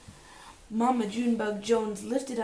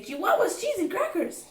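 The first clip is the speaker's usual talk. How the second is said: excited; 9 semitones higher than usual. A narrator's reading. Magic exclamation in story.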